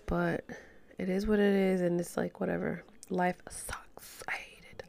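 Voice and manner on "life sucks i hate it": whispered despair. Real, not acted. End of rant, despondent.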